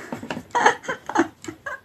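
high rising laugh at a mishap; embarrassed laugh. Real, not acted. Knocked the camera by accident.

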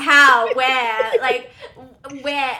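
loud, baffled retort; mock outrage, fired-off questions; incredulous. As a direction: throw the questions out loudly in disbelief. Retold baffled reply to classmate.

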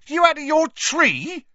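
high, loud startled yelp. Acted. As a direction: yelp it in shock. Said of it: "yelped Stan".

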